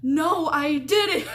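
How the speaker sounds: loud, high, drawn-out mock denial; mock heated denial. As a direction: exaggerate the roommate's protest, slow and wailing. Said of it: "going back and forth".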